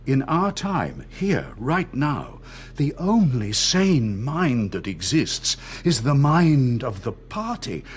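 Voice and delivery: slow, pressing every phrase; slow deliberate emphasis. Acted. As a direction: hammer the doctrine home slowly. Interrogator lecturing on party truth.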